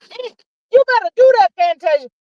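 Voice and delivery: high excited whoop for [name]; cheering [name] on. Retold or imitated. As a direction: cheer her on like you're in the crowd. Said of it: "I said".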